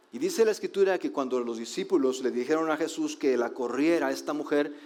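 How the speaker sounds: ordinary talk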